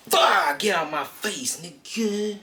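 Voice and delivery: angry yell. Retold or imitated. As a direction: bark it up high like a brawler hit in the face. Deathstroke yelling mid-fight.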